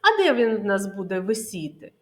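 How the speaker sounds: normal talking voice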